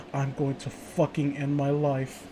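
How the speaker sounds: soft, slow and flat-faced; deadpan mock despair. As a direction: drop it quietly like a punchline.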